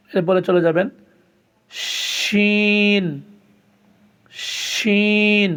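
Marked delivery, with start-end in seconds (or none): 1.7-5.5 শিন held long and firm, pitched up; firm Arabic shin; hard shin. Shown as a demonstration. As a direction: press শিন out with force. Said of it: "শক্ত করে উচ্চারিত করতে হয়".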